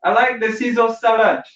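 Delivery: mock mum ordering salad; mama's voice. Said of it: "she was like".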